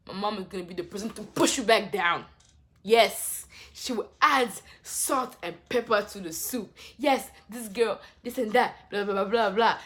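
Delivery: loud rant. Real, not acted. Ranting about mom.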